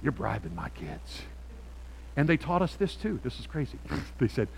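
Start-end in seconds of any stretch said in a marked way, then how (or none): 0.0-1.2 imitating parents. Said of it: "some of them be like".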